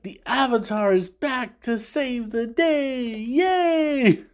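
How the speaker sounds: high, slow, hammed-up hero cheer; stage Aang actress cheering, very high and hammy; cheesy stage actress. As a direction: overact it like a bad play. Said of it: "she's like".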